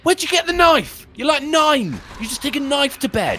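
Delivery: incredulous mockery. Real, not acted. Streamer scoffing at the kid's knife in the story, high and incredulous. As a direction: laugh off the story's logic.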